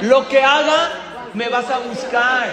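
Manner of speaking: slow, loud, high-pitched protest; acted cry of a fed-up victim; exasperated outburst. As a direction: shout it out slowly, fed up. Fed-up victim confronts the tsar.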